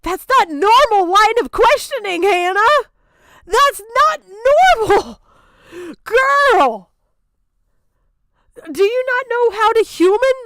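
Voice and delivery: high, loud, furious rant; exasperated yelling. Real, not acted. Scolding the questioner for grilling a child, yelling. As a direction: shout it at the screen in disbelief.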